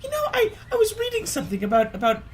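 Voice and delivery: very high, fast chirpy mom voice; high mom impression. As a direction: squeak it out like a breezy mom. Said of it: "And then she's like".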